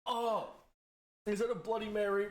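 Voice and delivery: shocked. Real, not acted. Just tasted hot sauce.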